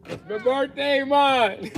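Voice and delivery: put-on slow, drawn-out Jamaican accent. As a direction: say it with a Jamaican lilt. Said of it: "I just wanted to say it in the Jamaican accent".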